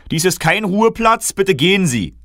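high, loud officious guard voice; guard ordering the kings to leave, loud and officious; stern guard. Acted. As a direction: order them out like a fussy guard. Security guard shoos the kings.

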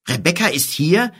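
high, eager burst of disbelief; boy exclaiming about his sister; excited cry. A narrator's reading. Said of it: "rief Kim".

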